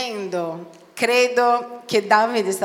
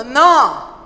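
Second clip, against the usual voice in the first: loud, cutting no; emphatic refusal; 8 dB louder than usual. Acted. Preacher rebuts the objecting believer, emphatic. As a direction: shut the objection down firmly.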